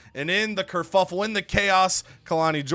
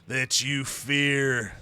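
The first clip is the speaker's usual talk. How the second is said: slow, low, drawn-out menacing impression; The Undertaker; 5 semitones lower, slower than usual. Wrestler warning Tony he will rest in peace, slow and ominous. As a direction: intone it slowly and low, like a deathly threat. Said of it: "Then The Undertaker comes out to the ring".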